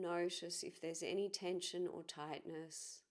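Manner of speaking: calm, measured guiding voice with long pauses; guided meditation. Acted. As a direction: ease listeners gently inward, leaving space between phrases.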